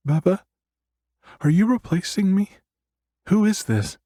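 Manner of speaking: put-on very high, jealous child voice; little girl voice.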